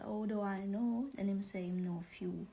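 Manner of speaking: ordinary talk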